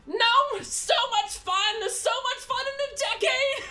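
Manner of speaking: put-on high, loud cackling old lady; elderly woman whooping with joy mid-battle; gleeful old woman. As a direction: laugh through it like a delighted granny. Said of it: "this elderly redheaded woman, going".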